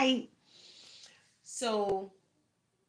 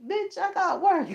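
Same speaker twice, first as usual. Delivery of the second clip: sassy retort; 12 semitones higher than usual. Retold or imitated. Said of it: "And I'm like".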